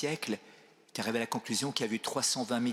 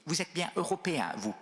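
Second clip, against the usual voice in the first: higher-pitched, lightly imitated Chinese accent; slight Chinese accent; 6 semitones higher than usual. Chinese bishop to his visitor, imitated slight Chinese accent. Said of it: "Avec son petit accent".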